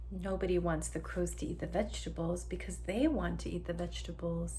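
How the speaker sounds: ordinary delivery, nothing marked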